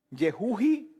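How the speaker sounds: Yehuji said as ye-HU-hi; "Yehuji" ending in a hi sound; Yehuji pronunciation variant. Shown as a demonstration. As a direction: say Yehuji with a breathed h for the j. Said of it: "Hay personas que lo, dicen".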